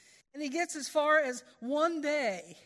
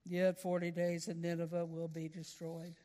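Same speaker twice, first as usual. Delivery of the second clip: lower, mumbled as if looking down at the floor; lousy reluctant preaching; 6 semitones lower than usual. Shown as a demonstration. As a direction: preach it half-heartedly, eyes on the ground. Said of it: "I say he preached it like this".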